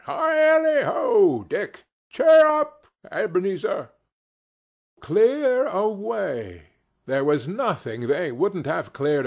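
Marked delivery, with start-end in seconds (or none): 0.1-3.9 loud, high, whooping call; rally the lads with merry shouts; exuberant, hearty old master's halloo; jolly holler. A narrator's reading. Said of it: "cried old Fezziwig". 5.0-6.7 slow, high, drawn-out exclamation; rousing cry. A narrator's reading. Narrator echoes Fezziwig's rallying order.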